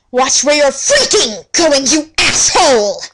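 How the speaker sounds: angry yell. Acted. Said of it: "I screamed loudly".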